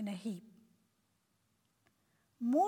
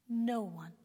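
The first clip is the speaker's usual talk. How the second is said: ordinary delivery, nothing marked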